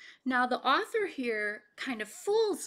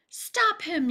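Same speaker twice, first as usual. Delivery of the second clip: high, loud call; yelled; 8 semitones higher, 6 dB louder than usual. Acted. Men yelling after Bullfrog, high and loud. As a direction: shout the order. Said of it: "yelled the men".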